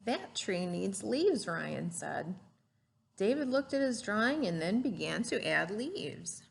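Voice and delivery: lively storybook read-aloud; gentle classroom story voice; reading to children. Acted. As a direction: read it like a picture book to a class. Teacher reads picture book aloud.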